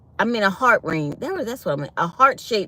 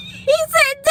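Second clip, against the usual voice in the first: laughing; 4 dB louder than usual. Real, not acted. Laughing, squeaky high.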